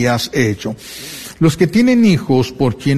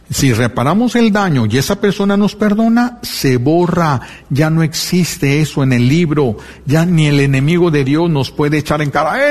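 usual speaking voice

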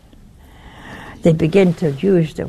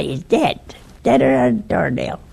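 usual speaking voice

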